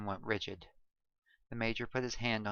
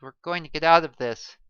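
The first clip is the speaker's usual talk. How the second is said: louder, firm resolve; determined; 5 semitones higher, 11 dB louder, faster than usual. A narrator's reading. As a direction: promise them they'll survive. Rallying the crew.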